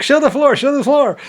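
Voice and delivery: high excited repeated plea; urging. Retold or imitated. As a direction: egg the camera on. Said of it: "I'd be like".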